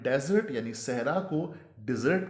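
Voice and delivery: first डेजर्ट as DEZ-ert, second as di-ZERT; contrasted pronunciations. Shown as a demonstration. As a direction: shift the stress between the two डेजर्ट. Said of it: "के साथ mix नहीं". (पहला डेजर्ट "डेज़र्ट", दूसरा "डिज़र्ट")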